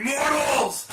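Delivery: loud high shouted cry of a possessed man; demon roar. Retold or imitated. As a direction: bellow it at the camera like a demon. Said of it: "Sorta like I do".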